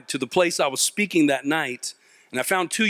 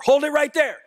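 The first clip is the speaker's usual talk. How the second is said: high-pitched shouted command; yelled police order; 10 semitones higher, 3 dB louder than usual. Retold or imitated. Sheriff ordering a suspect from squad car, high yell. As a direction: call it out like a cop. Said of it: "he yells at me out the window".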